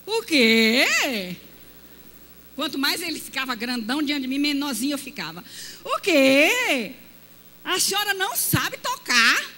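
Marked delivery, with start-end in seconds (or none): 0.1-1.4 loud astonished question; shocked o quê. Retold or imitated. Church brother shocked the pastor's wife can't play, loud. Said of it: "elder shocked she can't play". 5.8-9.5 loud, high disbelief; can't believe what he hears; astonished. Retold or imitated. Church brother asking the pastor's wife, loud astonished. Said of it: "elder shocked she can't play".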